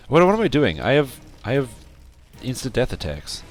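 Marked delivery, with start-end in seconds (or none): none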